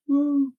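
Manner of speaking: small high-pitched "woo" of triumph; celebratory woo. Pretend. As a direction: cheer yourself with a high woo. Cheering own first class degree.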